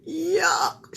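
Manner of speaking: high whispered yuck; child refuses beans; disgusted yuck. A narrator's reading. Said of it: "she said".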